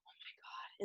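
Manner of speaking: breathless whispered oh my God; shocked whisper. Retold or imitated. Realizing he hurt his sparring partner, shocked whisper. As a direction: whisper it, horrified at what you did.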